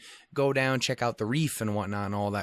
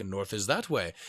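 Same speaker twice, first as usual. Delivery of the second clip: lower, softer counter-claim; second guy insisting; 4 semitones lower, 4 dB quieter than usual. Retold or imitated. The other local pointing the opposite way, lower and quieter. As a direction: flatly contradict him. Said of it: "And the other one's like".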